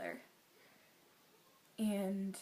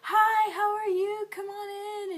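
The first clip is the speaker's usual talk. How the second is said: imitating an over-the-moon hello, pitched way up; excited greeting; 12 semitones higher, 11 dB louder than usual. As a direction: greet them as if thrilled to see them. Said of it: "he was so excited to see me".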